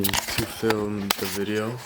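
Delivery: ordinary talk, nothing marked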